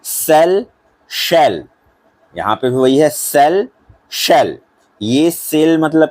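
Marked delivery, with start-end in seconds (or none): 0.0-4.6 सेल said with a plain s, शेल with a sh sound; teacher drilling सेल then शेल; sell versus shell. Shown as a demonstration. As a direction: contrast सेल and शेल clearly. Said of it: "दूसरा".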